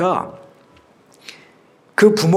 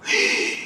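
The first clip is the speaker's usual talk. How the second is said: short acted gasp; sharp intake of shock; startled gasp; 6 dB quieter than usual. Disciples shocked at the calmed sea, acted gasp. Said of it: "너무 놀랐어요".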